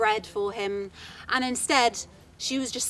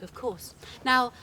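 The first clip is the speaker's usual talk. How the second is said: plain reading voice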